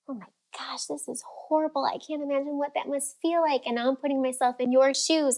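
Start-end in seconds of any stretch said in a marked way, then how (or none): 0.1-3.6 whispered gasp, then higher and aghast; mock empathy. Shown as a demonstration. Coach demonstrating empathy with an upset client, aghast. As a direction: gasp it, then pour on the shared shock. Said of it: "have empathy with you".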